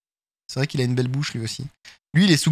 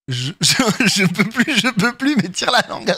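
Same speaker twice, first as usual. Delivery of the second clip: laughing strained voice; 6 semitones higher than usual. Retold or imitated.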